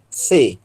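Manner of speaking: "C" said as "thê" with the tongue out; English-style "thê" in place of "C". Shown as a demonstration. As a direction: push air over the tongue tip for "C", like English TH. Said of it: "O T com a língua pra fora".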